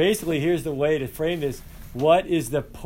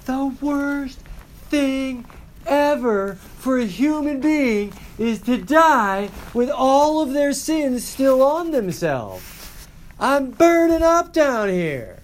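high, loud, slow and drawn out; hammed-up cry from the flames; imitated anguished yelling; 12 semitones higher, 8 dB louder, slower than usual. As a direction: yell it as a tormented stage ghost. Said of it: "yell that at my students".

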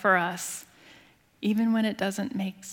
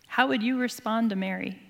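ordinary talk, nothing marked

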